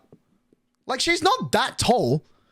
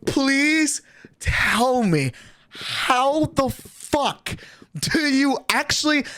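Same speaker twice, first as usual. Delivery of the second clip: very slow, drawn-out higher-pitched plea; exasperated disbelief; 5 semitones higher, much slower than usual. Real, not acted. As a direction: stretch every word out in disbelief. Incredulous plea about her demands.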